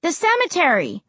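high-pitched call back. A narrator's reading. Child to Ray while following Josh, calling back. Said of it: "I called back".

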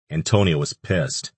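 normal talking voice

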